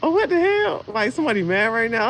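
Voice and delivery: high startled what the hell; a yelp of sudden confusion; alarmed. Retold or imitated. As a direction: jump up in pitch, caught off guard. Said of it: "I'm like".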